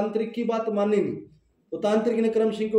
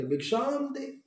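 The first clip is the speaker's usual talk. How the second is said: calling for alms; 7 semitones higher, 5 dB quieter, slower than usual. Acted. Disciple calling alms at door.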